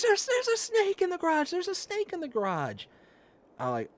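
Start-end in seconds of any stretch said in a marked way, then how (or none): 0.0-2.9 imitating the sister-in-law's frantic, much higher cry; mock panic in a very high voice; panicked high shriek. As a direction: squeal it out like you just saw a snake. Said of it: "and she's".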